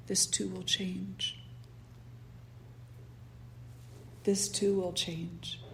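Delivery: slow, soft, solemn reading; solemn inscription. Acted. Ring maker's inscription read to the king, slow and hushed. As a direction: let each word land slowly and quietly.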